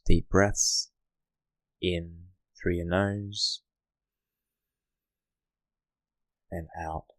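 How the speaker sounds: hushed guiding voice for settling in; guided meditation. Acted. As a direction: ease the listener into stillness, gently.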